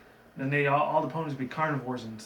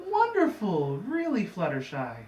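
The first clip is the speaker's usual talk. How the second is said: mock-pleased leader; 6 semitones higher, slower than usual. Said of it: "It's like".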